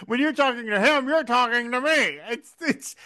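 ordinary talk

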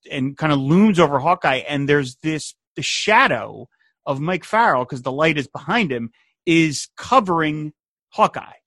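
ordinary talk

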